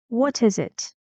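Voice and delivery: "What is it" peaking on "is", then down; falling intonation. Shown as a demonstration. Teacher repeats the question, falling "it". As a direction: close "it" with a drop. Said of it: "Falling intonation".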